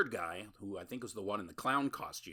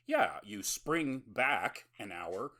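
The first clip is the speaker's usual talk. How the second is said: mock confident vampire; 5 semitones higher, 5 dB louder, slower than usual. Dim vampire answers confidently.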